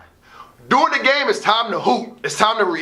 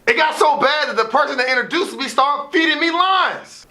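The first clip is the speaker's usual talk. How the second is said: ordinary talk, nothing marked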